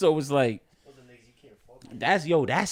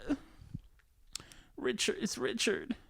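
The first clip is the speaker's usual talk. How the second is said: mock grieving girlfriend; 7 semitones higher, 7 dB quieter than usual. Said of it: "And she's like".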